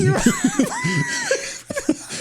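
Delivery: high, squealing burst of laughter; real high-pitched belly laugh; uproarious laughter. Real, not acted. Cracking up at co-host's bit.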